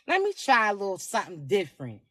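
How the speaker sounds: murmured low, like trying on a new look; playful low-pitched self-talk; coy musing. Acted. Voices a shy private self-thought.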